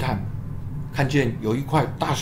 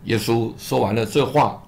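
ordinary delivery

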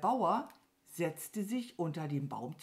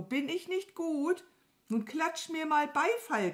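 high, pleased cow character; proud Mama Mu; 11 semitones higher than usual. Acted. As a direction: show off in a high voice. (stolze, hohe Kuhstimme)

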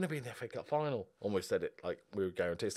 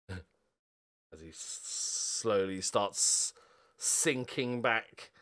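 slow; much slower than usual. Real, not acted. Mocking time-wasting player.

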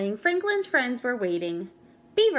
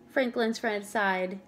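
plain reading voice

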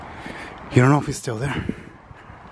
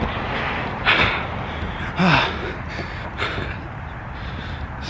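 long breathy sigh after running; heavy exhausted sigh; 6 semitones higher, 4 dB quieter than usual. Real, not acted. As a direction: let out a worn-out sigh. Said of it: "I'm running out of breath".

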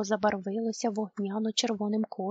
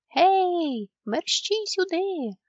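high, loud call, drawn out slowly; yelling call; 8 semitones higher, 5 dB louder, much slower than usual. A narrator's reading. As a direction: call friends over urgently. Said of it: "раптом заволав Чмих". (гучний поклик)

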